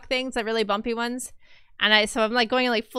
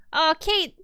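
ordinary talk

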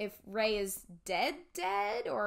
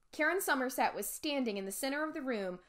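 ordinary delivery, nothing marked

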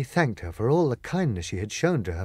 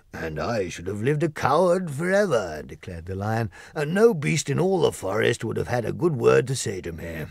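a grand beast dreaming of his kingdom; Lion voice. Acted.

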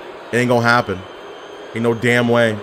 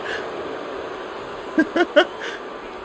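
long high breathy laugh; 9 dB quieter than usual. Real, not acted.

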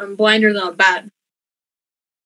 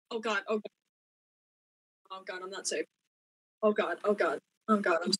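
hushed fear; 2 semitones lower, 14 dB quieter, faster than usual. Pretend. Hiding from a threat in game.

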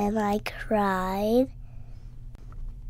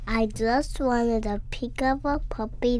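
ordinary delivery

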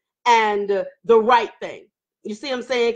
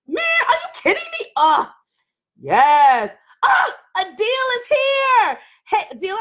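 excited greeting; 9 semitones higher than usual. Real, not acted.

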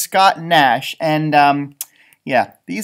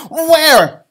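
high, loud, eager where; a girl searching all around; eager question; 11 semitones higher, 10 dB louder than usual. Acted. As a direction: ask it excitedly. Pam looks all over.

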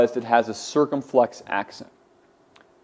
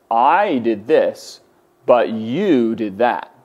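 "I" and "you" drawn out for contrast; slow contrast, stressing "I" against "you"; contrastive stress; much slower than usual. Shown as a demonstration. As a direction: lean slowly on "I", then on "you". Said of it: "the emphasis is a matter of contrast".

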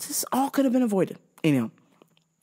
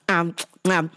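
ordinary delivery, nothing marked

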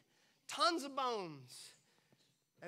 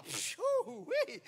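amused whistle; 14 semitones higher, 4 dB louder than usual. Real, not acted. Laughing at his own joke.

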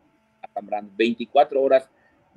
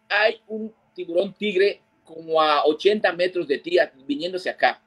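urgent warning; 6 semitones higher than usual. Retold or imitated. Guide warns of shark.

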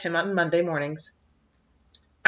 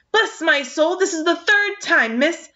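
high, loud scolding; raging at the girl; furious old man; 10 semitones higher, 3 dB louder, slower than usual. A narrator's reading. Angry farmer scolds Anne, loud and high. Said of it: "purple with rage".

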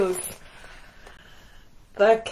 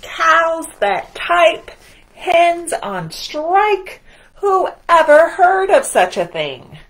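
loud, higher, slow incredulous rant; outraged disbelief; 10 semitones higher, 9 dB louder, slower than usual. Acted. Farmer fuming about the strike, loud and slow. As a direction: build the outrage word by word.

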